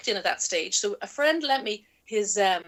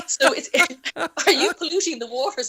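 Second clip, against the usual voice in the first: laughing question; 5 semitones higher, 3 dB louder than usual. Retold or imitated. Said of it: "actually starts to laugh".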